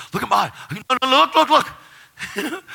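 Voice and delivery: high, loud frantic excitement; freaking out. Retold or imitated. Waking his roommate to show his eyes, freaking out. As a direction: urge him to look, voice shooting up. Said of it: "I'm freaking out".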